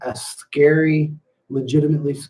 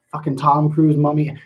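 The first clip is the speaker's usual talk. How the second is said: ordinary talk, nothing marked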